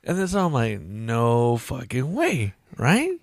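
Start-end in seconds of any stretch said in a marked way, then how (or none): 0.8-2.5 very slow drawn-out disbelief; slow shocked disbelief. Retold or imitated. Realising she's the mom, slow disbelief. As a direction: stretch it out in disbelief.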